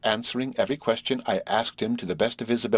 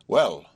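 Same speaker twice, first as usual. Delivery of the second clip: a louder, lower "Well"; emphatic; 5 semitones lower, 5 dB louder than usual. A narrator's reading. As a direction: open emphatically, pitch dropped. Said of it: "replied Horace emphatically".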